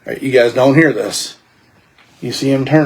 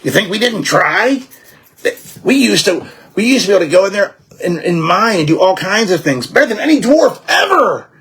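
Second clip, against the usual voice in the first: high-pitched, defensive protest; indignant outburst; 8 semitones higher than usual. Acted. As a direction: rise into a wounded, boastful rant. Informant indignantly defends his own people.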